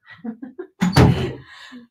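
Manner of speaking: breathy burst of laughter; embarrassed laugh. Real, not acted. Streamer laughs at her cat's timing, unvoiced laugh. Laughs after cat interruption.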